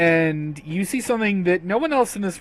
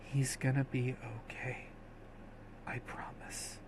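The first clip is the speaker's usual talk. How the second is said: much softer, fading to a whisper; whispered reassurance; 3 semitones lower, 19 dB quieter than usual. Acted. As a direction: promise it softly while holding him. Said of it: "she says (holds you closer)".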